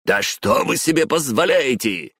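high-pitched outraged question; scandalised outburst; indignant cry. A narrator's reading. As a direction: exclaim in outrage without shouting hard. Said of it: "возмущённо вскрикнул Дзеро".

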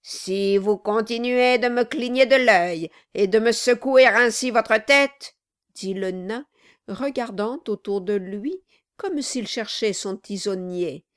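loud, menacing warning; threatening dwarf. Acted. Quilp threatens Brass while looking for the poker, menacing. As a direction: threaten him outright.

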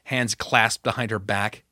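plain reading voice

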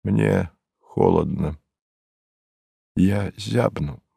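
soft, halting, understated misery; subdued desolation. A narrator's reading. Lonely narrator confesses he is cold, subdued and desolate. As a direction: say it small, with long pauses.

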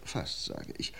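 hushed, almost breathless under the voice; whispered aside. Acted. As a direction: drop to a whisper to land the point. Detective stresses a point quietly.

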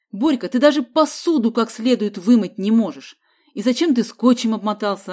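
loud, exasperated telling-off; scolding. A narrator's reading. Uncle scolds nephew over the flood, exasperated. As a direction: berate him with mounting exasperation.

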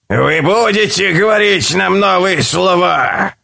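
high eerie monster voice; a hungry creature asking. A narrator's reading. Creature asks.

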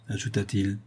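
plain reading voice

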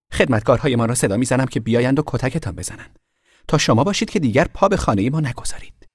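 high, loud haughty threat; threatening girl. A narrator's reading. Girl threatening to call servants on the boy. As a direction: threaten him imperiously.

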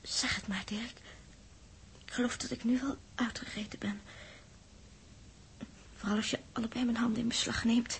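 low, resigned whisper. Acted. Els urging Dirk to tell her, resigned whisper. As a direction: whisper it, bracing for the worst.

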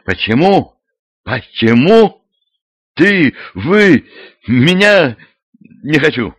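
sputtering with outrage; breathless indignation. A narrator's reading. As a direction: choke on the indignation. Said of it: "аж задохнулась от возмущения".

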